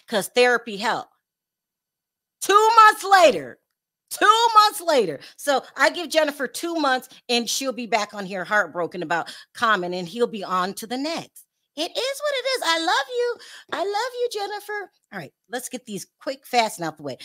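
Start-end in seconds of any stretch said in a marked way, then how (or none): 2.4-5.2 high, loud, drawn-out proclamation; emphatic mock announcement. Real, not acted. Gossip host mocking a failed reform, loud emphatic announcement. 11.7-14.9 very high, lilting sign-off; high sing-song affection. Real, not acted. Host signing off to Jennifer, high sing-song affection.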